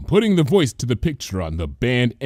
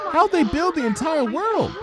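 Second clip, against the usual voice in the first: put-on much higher curious child; high childlike question; small student voice. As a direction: ask it like an awed little foal. Student asks.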